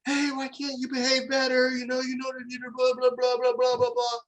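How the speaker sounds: high, whiny mock-scolding parent voice; mock nagging parent. Parents scolding their bickering kids, high mock nagging. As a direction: parody a parent droning a lecture. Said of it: "the parents are like".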